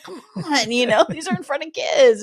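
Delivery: high-pitched mock reproach; retold pleading protest. Ben to Olivia in front of kids, mock-exasperated. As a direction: imitate a playful scolding plea. Acting Ben's protest at her answer.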